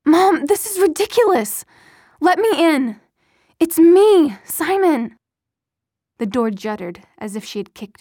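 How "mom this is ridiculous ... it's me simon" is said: loud, high, desperate pleading; frantic shouting. Acted. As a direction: yell to be let in, voice straining. Son pleading at locked door.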